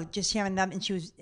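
usual speaking voice

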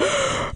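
high gasp of awe; exaggerated delighted intake of breath; mock gasp. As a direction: gasp as if smitten. Imagined reaction to the picture.

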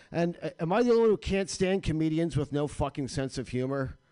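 normal talking voice

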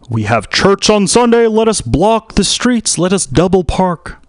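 high-pitched mock churchgoer's plea; high, pleading caricature of a parishioner; imitated community member.

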